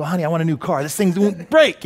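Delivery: hammed-up grumble about the old car; mock exasperation. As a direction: whine in pretend frustration, rising on the last words. Acting out a fed-up car owner.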